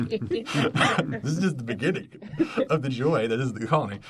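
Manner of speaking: talking through laughter; words broken by giggles; laughing. Real, not acted.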